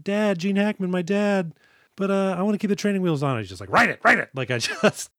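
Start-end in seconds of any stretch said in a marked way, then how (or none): 0.0-3.3 put-on higher, quick little-kid plea; pleading kid. Child begs dad to keep training wheels, high pleading voice. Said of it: "And like". 3.7-4.3 gruff, loud and higher push; a gruff father barking at his kid; gruff dad. Retold or imitated. Gruff dad orders child to ride bike, loud gruff command. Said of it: "he's gruff, David".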